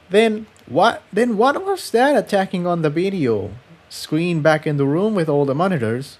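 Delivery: lightly voiced girl, uneasy; worried young mare. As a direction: pitch up and hurry the question. Tiptoe worried question.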